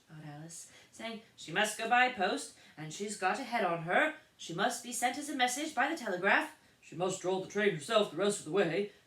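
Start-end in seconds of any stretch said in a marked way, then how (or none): 1.4-8.9 loud, higher crowd of voices; chorus of voices. A narrator's reading. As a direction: pile on one after another. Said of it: "other voices went on".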